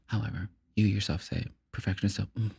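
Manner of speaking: soft hush. Pretend. Podcaster gently addressing [name], soft hush. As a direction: keep it hushed and intimate.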